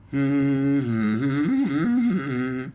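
soft hummed little tune; light, wordless humming; happy humming. A narrator's reading. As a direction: hum contentedly to yourself. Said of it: "Humming a happy little tune to herself".

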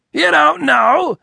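very high, loud, stretched-out echo; outraged disbelief. A narrator's reading. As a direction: throw it back in scornful amazement. Boss incredulously echoes the confession.

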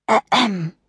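mock throat-clearing ahem; pointed cough. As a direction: clear the throat to get noticed. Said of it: "coughed Anna".

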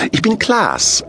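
very high character voice; high-voiced thin Santa. A narrator's reading. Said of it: "sagte der Dünne".

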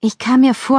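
plain reading voice

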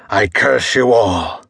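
a touch of a cursing victim's scream, lightly voiced; mild mock curse scream. A narrator's reading. Burning man cursing the mob, lightly voiced scream. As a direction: voice a burning man's curse, understated. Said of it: "Copper screamed".

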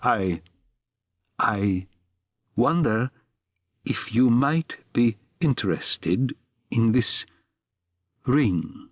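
slow, faltering offer with long pauses; halting with effort. A narrator's reading. Stranger forcing out an offer to the pawnbroker, halting. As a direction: force each word out haltingly. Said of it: "By a great effort of will he was able to speak".

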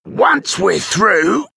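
slow, high, effortful character voice; older thief at work. A narrator's reading. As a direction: speak between swings of a pickaxe. Older thief talks between pickaxe swings.

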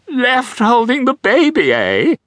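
put-on very high gushing posh girl; gushing daughter. A narrator's reading. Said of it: "gushed the toothy daughter".

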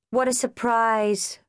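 slow, dry sarcastic line; dry sarcasm. A narrator's reading. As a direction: let the sarcasm drag. Sarcastic reply to twins.

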